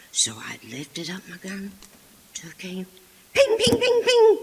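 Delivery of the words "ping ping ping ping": very high, loud rapid pings with a laugh; imitated pings. Girl hitting the clothesline again and again, gleeful pings. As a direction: fire them off like quick pellet hits.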